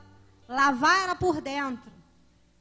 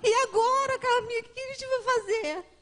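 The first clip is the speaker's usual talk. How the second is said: higher, urgent retelling of a panicked call; panicked sister; 4 semitones higher, 6 dB louder than usual. Retold or imitated. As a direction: voice the sister's alarm on the phone. Irmã desesperada ao telefone. (irmã em pânico, voz mais aguda)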